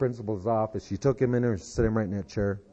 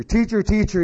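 high, louder imitation of an excited boy; 10 semitones higher, 5 dB louder than usual. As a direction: call teacher like an excited kid. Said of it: "boy, he was excited".

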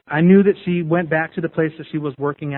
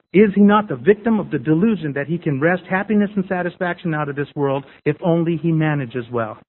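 ordinary talk, nothing marked

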